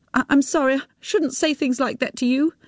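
high, hurried apology; backing down quickly; apologetic woman. A narrator's reading. She apologises to Tim, flustered.

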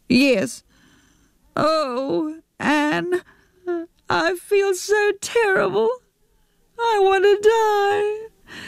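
high, slow, breaking with tears; a narrator's high, slow, tearful Jackie; tearful despair. A narrator's reading. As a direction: sob out the confession. Jackie admits crying, despairing.

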